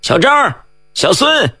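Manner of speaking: high, drawn calls to friends; calling out. A narrator's reading. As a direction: call their names as if running up. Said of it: "喊着奔来".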